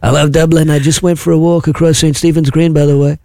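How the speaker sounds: boastful American politician impression; self-praising impression with tagged-on checks for agreement; Trump. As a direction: brag in a put-on American presidential voice. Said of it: "Because I'm Trump".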